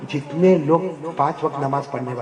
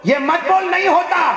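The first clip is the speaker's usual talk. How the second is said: high, raised scolding; angry divine rebuke; 13 semitones higher, 5 dB louder, slower than usual. Acted. Allah rebuking a servant who claims he cannot bow, angry. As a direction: rebuke it sharply at a high pitch. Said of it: "اللہ غصے میں کہیں گے".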